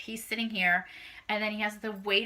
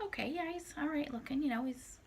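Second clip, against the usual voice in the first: soft high aside; 7 semitones higher, 10 dB quieter than usual. Retold or imitated. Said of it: "And I'm like".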